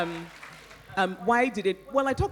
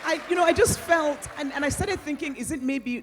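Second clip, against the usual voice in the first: exasperated disbelief; 10 semitones higher than usual. Real, not acted. Author telling the audience how baffled she felt, exasperated and rising. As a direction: sputter in disbelief, then burst out.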